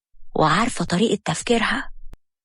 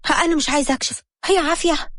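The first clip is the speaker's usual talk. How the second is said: higher, whiny refusal; Habiba refusing; 7 semitones higher than usual. A narrator's reading. Wife refusing the check-up, higher and whiny. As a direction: pitch it up and dig in.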